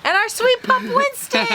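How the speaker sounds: high cooing pet voice; baby voice. Acted. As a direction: coo it like to a puppy. Cooing over the dog.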